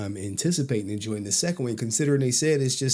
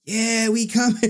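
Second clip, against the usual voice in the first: high hyped-up cheering, climbing higher; mock excited crowd; 9 semitones higher than usual. Fans heading to LA for Splat Con, mock excitement. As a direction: play the eager fans, pitch rising to a squeal.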